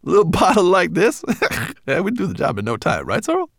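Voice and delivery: high chuckling brownie; cheeky laughing brownie. Acted. Berber Chan laughs.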